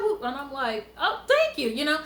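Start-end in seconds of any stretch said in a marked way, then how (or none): 1.0-1.7 higher-pitched humble thanks. Retold or imitated. Woman acting out her reply to compliments, humble. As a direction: pitch up into a modest thank-you. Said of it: "I'm being humble".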